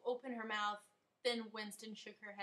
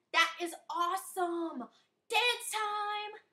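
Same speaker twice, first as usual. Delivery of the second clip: excited; 9 semitones higher, 7 dB louder, slower than usual. A narrator's reading. Amy cheers, singing.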